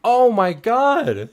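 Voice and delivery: high, slow, thrilled shout; excited shout. Real, not acted. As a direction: shout it in sudden delight. Streamer notices creator in chat.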